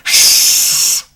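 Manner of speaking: loud mock shushing hiss; exaggerated shh for a secret everyone knows; mock hush. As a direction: shush the whole town, hammed up. Mocking the hero's secrecy.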